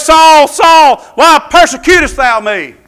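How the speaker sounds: loud, high shouted summons; thundering preacher's shout; commanding divine call. Acted. Voice of the Lord acted out.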